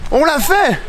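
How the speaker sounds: high, loud shout of triumph; excited. Real, not acted. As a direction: cheer it out, thrilled. Triumph at reaching the beach.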